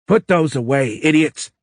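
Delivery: furious presidential order; angry shout. Acted. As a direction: snap at the agents like fools. President barks at armed agents.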